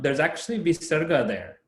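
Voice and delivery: ordinary talk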